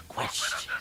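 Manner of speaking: unvoiced, soft game-character impression; Cypher. Caster mimicking the game for co-caster, hushed character impression. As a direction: imitate the game's voice line, hushed. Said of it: "That was a good impression".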